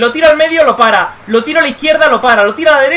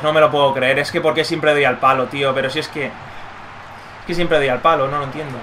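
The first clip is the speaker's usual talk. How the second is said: ordinary talk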